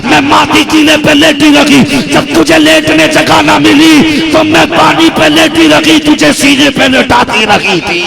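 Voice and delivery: high, loud impassioned preaching; preaching at full force. Acted. Preacher voices mother's rebuke, impassioned and loud. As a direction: soar into the emotional climax.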